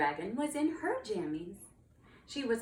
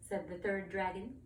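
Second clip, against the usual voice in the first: ordinary delivery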